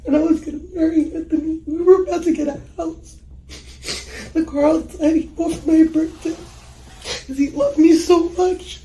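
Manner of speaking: high, loud, tearful grief; real crying, pouring the words out through tears. Real, not acted. Woman grieving the man she planned to marry, crying.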